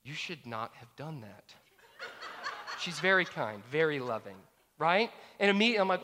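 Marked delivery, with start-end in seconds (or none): 0.0-1.6 soft rebuke. Retold or imitated. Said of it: "she just said".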